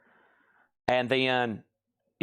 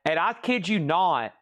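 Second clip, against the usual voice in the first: usual speaking voice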